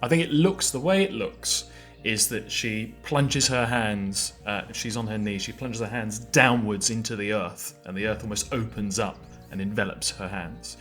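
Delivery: ordinary delivery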